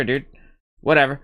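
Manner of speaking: ordinary talk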